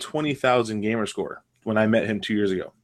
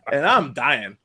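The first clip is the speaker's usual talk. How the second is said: ordinary talk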